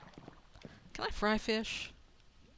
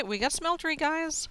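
excited; 7 semitones higher, 3 dB louder than usual. Real, not acted. Announcing smeltery to viewers.

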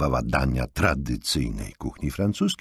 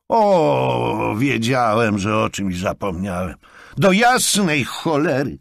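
higher, louder angry exclamation; annoyed outburst; 8 semitones higher, 6 dB louder, slower than usual. A narrator's reading. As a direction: curse at his own forgetting. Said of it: "zdenerwował się Jonathan". (zdenerwowany wybuch)

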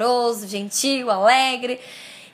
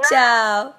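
usual speaking voice

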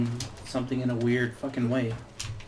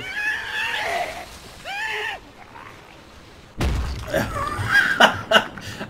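shrieking laugh. Real, not acted. Enemy suddenly blows up.

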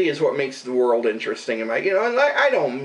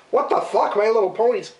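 surprised; 7 semitones higher than usual. Retold or imitated. Retold reaction to dumped toys.

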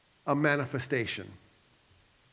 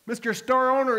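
put-on excited boy; 8 semitones higher, 4 dB louder than usual. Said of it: "all excited".

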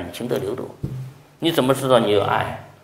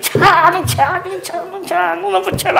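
pushy host; 5 dB louder than usual. Retold or imitated. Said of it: "有的弟兄上海人".

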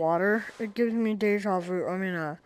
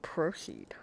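hushed, voiceless whisper; whispered cue; 6 semitones lower, 10 dB quieter than usual. Real, not acted. Player quietly telling the game to carry on, whispered. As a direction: whisper it low, barely audible.

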